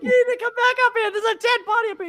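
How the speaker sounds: high, fast, panicky caller on the phone; frantic caller voice. Retold or imitated. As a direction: squeak it out like a scared hotel guest. Hotel caller reports dead body.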